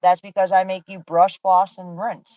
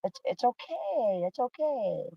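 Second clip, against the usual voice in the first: soothing; 5 semitones higher, 8 dB quieter, slower than usual. Real, not acted. Mother soothing numb daughter.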